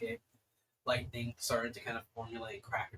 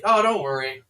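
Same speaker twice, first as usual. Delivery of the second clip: louder, lilting reassurance in character; breezy reassurance; 3 semitones higher, 14 dB louder than usual. Acted. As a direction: brush the worry off loudly. Game master voices a reassuring character.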